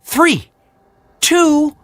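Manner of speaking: high, loud bird countdown; cried countdown. Acted. Hummingbird starting the race against Heron, high and loud. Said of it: "he cried".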